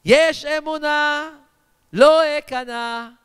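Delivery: loud, drawn-out rallying declaration on a sustained pitch; rousing preacher's declaration of faith; preaching. Acted. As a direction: proclaim each phrase forcefully, stretching the last words.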